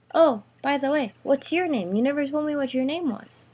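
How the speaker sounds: high, mock-innocent question; playfully needling. A narrator's reading. Greg having fun with the girl's boyfriend, mock-innocent. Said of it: "have a little bit of fun".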